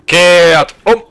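loud drill command; barked drill commands. Acted. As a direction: snap the order out.

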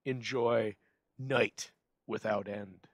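usual speaking voice

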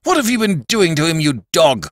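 high, loud accusing outcry; angry shout. A narrator's reading. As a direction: grab him and yell it at him. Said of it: "he cried".

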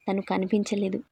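plain reading voice

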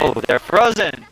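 high, loud delighted surprise; high, excited echo of amazement; amazed exclamation. Real, not acted. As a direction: call it out in loud wonder. Echoes amazement at frozen enemies.

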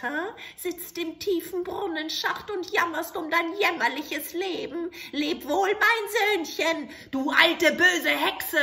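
put-on loud, high cackling farewell of an old hag, building; gloating witch. Witch gloating over the soldier trapped in the well. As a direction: crow over him from the rim.

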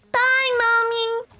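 put-on high, drawn-out toddler goodbye; little child. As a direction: play a tot calling bye in a small voice. Baby says goodbye.

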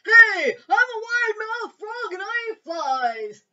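high, loud, slow cartoon frog. A narrator's reading. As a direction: boast like a cheerful big-mouthed frog. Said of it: "said the wide-mouthed frog".

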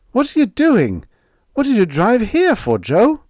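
high, loud demanding questions; alarmed shout. A narrator's reading. Harry confronting Joe at the stopped truck, shouting. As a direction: shout at him, rattled.

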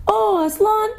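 very high, drawn-out cry of awe; children's overwhelmed exclamation; astonished cry. A narrator's reading. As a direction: cry out high, half frightened, half glad. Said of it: "as much frightened as they were glad".

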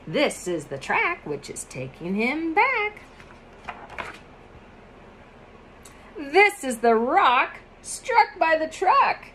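sing-song picture book reading; reading to children. Acted. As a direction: read it lightly to a small child.

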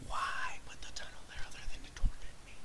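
quick hushed whisper of torment; whispered complaint. Real, not acted. Gamer complaining about the tunnel, quick aggrieved whisper. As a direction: whisper it fast and soft. Muttering after the outburst.

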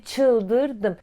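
"çıldırdım" drawn out very slowly; slow, dragged-out stress on "çıldırdım"; emphatic stretched word. Retold or imitated. Stressing how mad she went.

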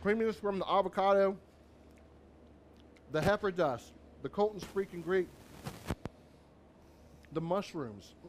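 talking through a mouthful; cheeks stuffed full. Real, not acted. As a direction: chew and talk at once. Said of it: "Don't talk with your mouth full".

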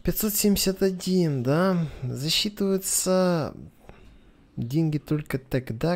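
ordinary talk

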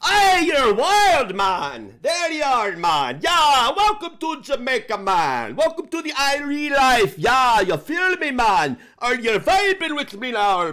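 high, loud Jamaican hype man act; mock Jamaican accent. Welcoming the listener to Jamaica, mock Jamaican accent.

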